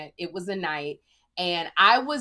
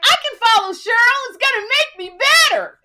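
high, loud, quick self-assured boast; mock cocky younger self, higher and louder; cocky bravado; 12 dB louder than usual. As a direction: oversell your own confidence. Retold cocky confidence before bombing.

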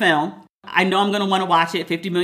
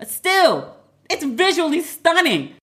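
excited insistence; 7 semitones higher, slower than usual. Real, not acted. Defending film, pitch jumps.

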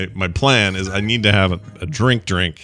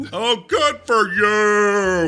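sarcastic shout, much higher and very slow; mock outraged shout; 11 semitones higher, much slower than usual. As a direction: yell it with hammed-up sarcasm, stretched out.

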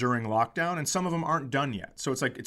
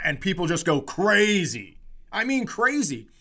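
normal talking voice

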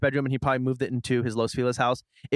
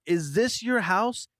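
amazed question; 6 semitones higher, slower than usual. Retold or imitated. Retelling amazed reaction to mansion.

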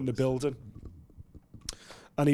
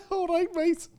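much higher, laughing Scouse imitation; Scouse accent. Guest gleefully repeating the fighter's reply, Scouse accent. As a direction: echo the Scouse greeting in a high, delighted voice. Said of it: "In a Scouse accent".

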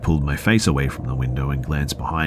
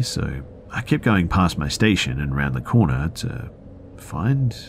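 ordinary talk

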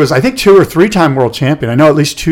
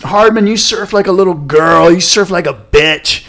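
retold freak-out; 5 semitones higher than usual. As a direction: imitate a rattled surfer taunting his rival. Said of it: "Dorian was freaking out and going".

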